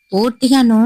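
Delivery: ordinary delivery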